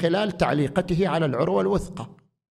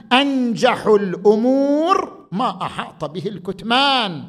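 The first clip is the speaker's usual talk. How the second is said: preaching; 6 semitones higher, 4 dB louder than usual. Acted. Raises voice for the quote.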